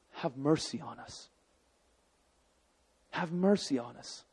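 very quiet, humbled begging; hushed, desperate appeal; soft plea. Acted. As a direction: plead for mercy under your breath. Lepers pleading with Jesus, acted.

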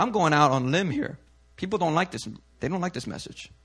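normal talking voice

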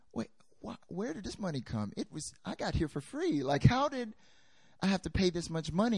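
high, hushed, stunned protest; incredulous disbelief. Retold or imitated. Teacher protesting the quitting fee, stunned and incredulous. Retelling his shock at the fee.

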